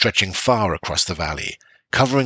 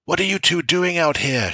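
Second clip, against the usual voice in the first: raised high shout; 10 semitones higher than usual. A narrator's reading. Father shouting at his children in the storm, high. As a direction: yell over the storm at the kids. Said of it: "shouted Bran".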